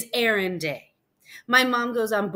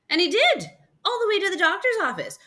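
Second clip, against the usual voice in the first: high, loud, lively storytelling burst; excited narration; 12 semitones higher, 6 dB louder, faster than usual. A narrator's reading. Storyteller revealing the dinosaur really followed, excitedly. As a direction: tell it with delighted amazement.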